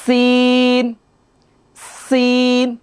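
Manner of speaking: সিন with a long breathy s and high pitch; airy sin. Shown as a demonstration. Teacher showing the air on সিন for students. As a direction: let the air hiss out on সিন. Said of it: "আমার সাথে বলেন".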